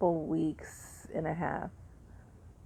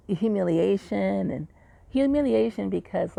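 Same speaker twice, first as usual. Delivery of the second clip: ordinary talk, nothing marked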